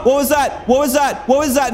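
high, loud panicked shouts; panicked yelling. Real, not acted. Startled player shouting at the monster, in panic. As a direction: yell it in real panic. Jump scare in horror game.

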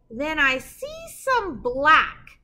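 slow, drawn-out build to the rhyme; suspense pause. Acted. Reading to kids and pausing before the rhyme, drawn-out suspense. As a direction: leave them hanging before the rhyme.

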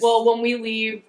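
loud. Real, not acted. Player cuts in to correct.

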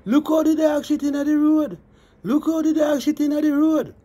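high sing-song jeer at the dog; mock passerby voice. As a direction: mimic a nosy passerby jeering. Mocking what passersby say.

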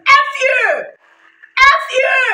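high-pitched yell, said twice; retold shouted retort; re-enacted yelled insult. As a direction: call it out high at the offender. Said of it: "I just said that sentence twice".

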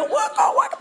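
high vocal record-scratch noise; quick squeaky DJ imitation; mock DJ scratching. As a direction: scratch an imaginary record with your voice. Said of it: "I should have been a D J".

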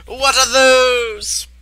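drawn-out high yelp of disbelief; high-pitched outcry. Acted. Reacting to those things, high-pitched outcry. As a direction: stretch the question out, high and slow.

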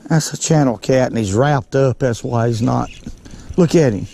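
ordinary delivery

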